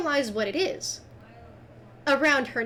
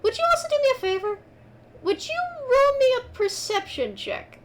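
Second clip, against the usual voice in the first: much higher, fast sweet request; sweet high ask; 11 semitones higher than usual. Acted. GM asks sweetly for a roll.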